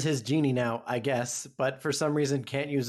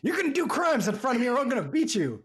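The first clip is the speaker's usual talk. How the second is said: mock threat; 8 semitones higher than usual. Acting out the violent movie dad.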